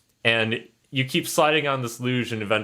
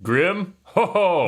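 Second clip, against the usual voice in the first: jolly ho ho; 8 semitones higher, 4 dB louder, slower than usual. Acted. Yule Papa laughs jollily.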